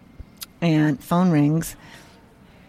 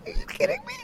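stunned disbelief; 6 dB quieter, much faster than usual. Retold or imitated. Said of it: "I'm like".

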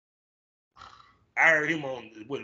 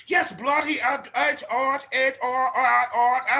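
high-pitched, stammering English accent impression; mock English accent; 10 semitones higher, slower than usual. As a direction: imitate an Englishman, pitched well up. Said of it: "I saw an interview with him".

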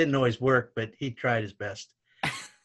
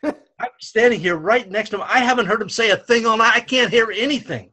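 loud, high-pitched exasperated retort; indignant retold protest; 8 semitones higher, 8 dB louder than usual. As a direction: re-enact arguing with a referee.

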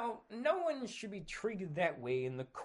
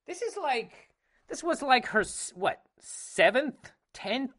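incredulous; 10 semitones higher, 9 dB louder than usual. Real, not acted. Incredulous counting of her films.

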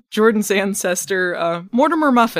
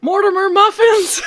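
hammy curse shout; 7 dB louder than usual. Pretend.

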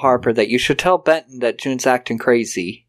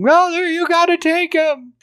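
high-pitched mock scream; Marie's retold scream. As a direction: imitate a desperate daughter crying out, pitched way up. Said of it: "runs out with Marie screaming".

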